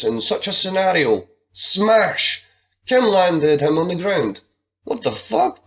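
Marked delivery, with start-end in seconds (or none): 4.8-5.6 much higher shocked voice; shocked exclamation. Acted. Buddy reacting to Juarez hitting the ground, high surprise. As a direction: caught off guard. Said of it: "Buddy exclaimed".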